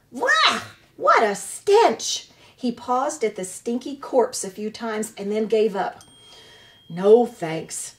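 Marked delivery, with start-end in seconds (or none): 0.1-2.3 much higher, louder, stretched-out cry of disgust; disgusted badger. A narrator's reading. A badger recoiling from a rotten corpse, high disgusted cry. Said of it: "face twisted with disgust". 6.9-7.9 grumbled. A narrator's reading. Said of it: "he grumbled to himself".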